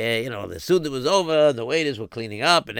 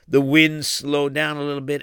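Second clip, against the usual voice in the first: ordinary delivery, nothing marked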